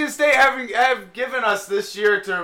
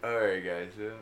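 radio break sign-off; 10 semitones lower, 6 dB quieter than usual. Acted. Host cuts to break.

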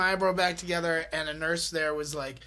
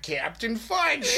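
mock heroic announcement; 5 semitones higher, 3 dB louder, much slower than usual. Calling out his cue on stage.

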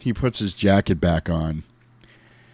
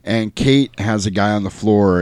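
normal talking voice

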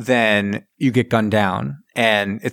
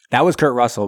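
ordinary talk, nothing marked

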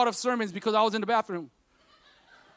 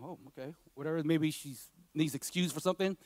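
dismissive inner thought; 9 semitones lower, 12 dB quieter than usual. Retold or imitated.

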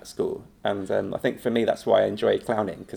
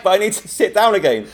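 pleading protest; 9 semitones higher, 4 dB louder than usual. Retold or imitated. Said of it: "I'm like".

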